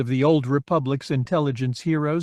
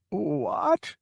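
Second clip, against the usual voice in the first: put-on confused old man; 8 semitones higher, 3 dB quieter than usual. A narrator's reading.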